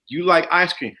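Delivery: steady, matter-of-fact prompt; leading instruction. Acted. Man telling a woman what she likes, instructing. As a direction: tell them as if instructing.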